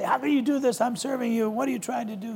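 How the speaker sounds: a hint of an aggrieved servant; mock complaint. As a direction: play the wronged servant pleading. Voicing David's imagined complaint to Saul.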